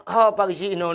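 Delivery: usual speaking voice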